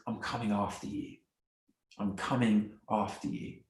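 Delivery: low, soft promise, gently measured; quietly tender. Acted. God promising to come after those turning away, quietly tender. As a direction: speak softly and low, as a loving pursuer.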